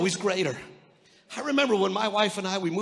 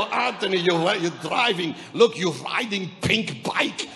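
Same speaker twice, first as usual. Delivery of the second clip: mock Russian accent, teasing; 3 dB louder than usual. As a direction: tease in a thick Russian accent. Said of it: "big Russian guys".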